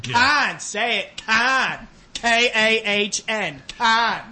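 loud, high Con, spelled K A H N slowly; shouted con for corn. Retold or imitated. Mother drilling kids yells Con and spells it. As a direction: drill Con letter by letter, yelling. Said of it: "telling her children how to say corn".

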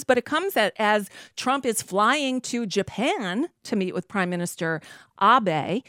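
ordinary talk, nothing marked

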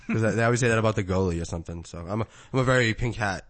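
ordinary delivery

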